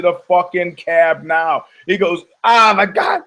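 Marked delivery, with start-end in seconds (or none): 2.4-3.2 raised, loud mock outcry; acted exasperation. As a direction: cry out as if fed up, playing the part. Acted exasperated cry in retold quarrel.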